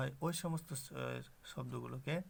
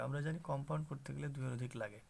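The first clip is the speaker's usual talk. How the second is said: ordinary delivery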